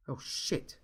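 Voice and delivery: whispered, hushed curse; under-breath curse. A narrator's reading. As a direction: swear quietly under breath. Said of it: "cursed Frankie".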